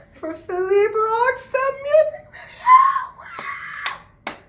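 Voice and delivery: high, loud excited cheer; excited shout. Real, not acted. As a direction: hype it up with a laughing shout. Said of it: "I'm excited".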